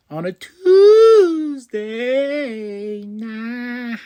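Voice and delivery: high, drawn-out mock cry; hammed-up wail. Real, not acted. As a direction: stretch it like a melodrama. Mock dramatic lament.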